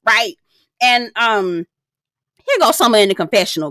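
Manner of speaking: ordinary delivery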